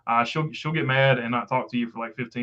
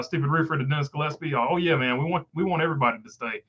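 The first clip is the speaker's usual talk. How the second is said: ordinary talk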